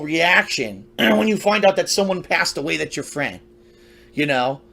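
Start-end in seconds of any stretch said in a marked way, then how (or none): none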